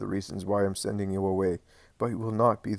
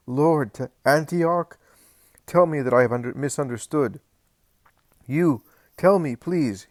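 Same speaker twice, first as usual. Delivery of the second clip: desperate pleading shout; 5 semitones higher, 7 dB louder than usual. Acted. John begging Jesus not to send him away, loud. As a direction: beg him to take it back. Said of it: "shouts John".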